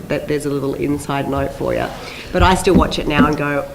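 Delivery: ordinary delivery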